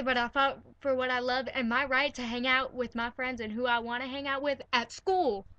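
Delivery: ordinary talk, nothing marked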